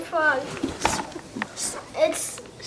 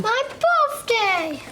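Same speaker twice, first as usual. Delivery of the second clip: higher, slow, delighted cry; excited; 6 semitones higher, much slower than usual. Real, not acted. Child excited about May.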